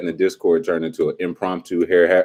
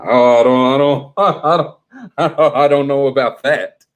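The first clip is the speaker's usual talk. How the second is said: laughing through a stammered I don't; half laughing, dodging the question; 6 semitones higher, 5 dB louder than usual. Real, not acted. Co-host asks, he laughs it off.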